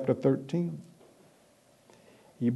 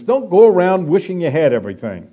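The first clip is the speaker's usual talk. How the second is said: ordinary talk, nothing marked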